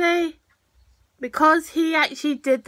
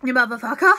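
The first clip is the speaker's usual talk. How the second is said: low, rising outburst; 4 semitones lower, faster than usual. Acted. Vlogger cursing at a character, low rising outburst. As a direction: lower voice lifting at the end. Same insult repeated lower, rising.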